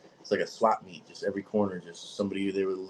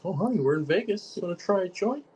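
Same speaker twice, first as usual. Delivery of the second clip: higher, sugary mock sales pitch; mock sweet seller; 11 semitones higher, 3 dB louder than usual. Mocking a Vegas seller luring new tourists, sugary and high. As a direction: lightly ham up a Vegas dealer coaxing tourists.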